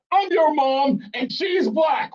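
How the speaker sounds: high, slow, drawn-out mock shout; insistent mother. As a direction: overrule your kid as a hammed-up Mom. Mother insisting over objection.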